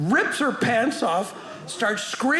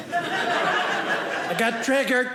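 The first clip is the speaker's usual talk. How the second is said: slow drawn-out triggered; 3 semitones higher, much slower than usual. Pretend. Comedian mocking touchy students, slow drawn-out whine. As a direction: drag out I got triggered very slowly with a laugh. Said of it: "It was good till I got triggered".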